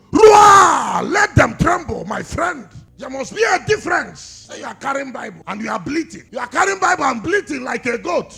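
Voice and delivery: roaring pulpit shout; full-force preaching. Acted. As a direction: cry it out to the congregation.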